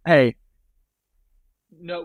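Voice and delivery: ordinary delivery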